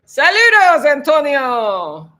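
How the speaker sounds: high, loud Spanish hello, name drawn out slowly; greeting a viewer in Spanish with a high, loud, drawn-out welcome; excited greeting. Real, not acted.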